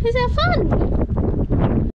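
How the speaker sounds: cooing at the dog after the walk; high pet voice. Real, not acted. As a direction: stretch it out sweetly for the dog. Talking to the dog.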